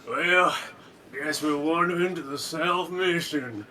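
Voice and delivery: slow lazy cowboy drawl; a hint of a Western cowboy. Shown as a demonstration. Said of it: "I just talk to myself in, like, a cowboy voice".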